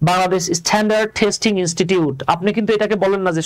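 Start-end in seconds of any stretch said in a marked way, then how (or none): none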